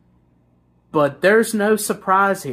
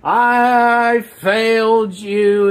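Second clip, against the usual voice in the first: slow, stretched-out mock whine; mock crying; 3 semitones higher, much slower than usual. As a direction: whimper it like an exaggerated crybaby. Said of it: "he's crying like a little bitch".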